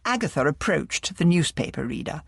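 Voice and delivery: usual speaking voice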